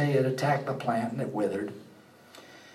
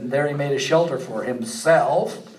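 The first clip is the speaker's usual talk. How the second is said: ordinary talk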